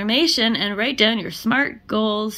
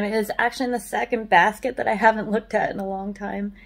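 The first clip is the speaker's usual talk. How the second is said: ordinary talk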